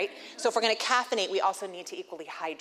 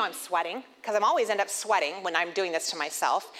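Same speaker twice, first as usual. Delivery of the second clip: ordinary delivery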